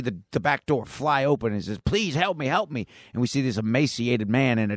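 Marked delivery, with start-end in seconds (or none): 1.9-2.9 higher-pitched imitated cry for help; mock desperate plea. Escaping diaper man begs for help, mock desperate. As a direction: plead urgently as an escaping captive, lightly acted.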